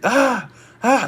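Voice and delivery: high, loud mimicked yell; high wordless mock scream; imitated crazed shout. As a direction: mimic a crazy man yelling. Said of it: "He's shouting like".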